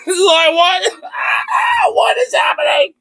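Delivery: very high, squealing laughing disbelief; incredulous laughter. Real, not acted. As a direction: squeak it out while cracking up.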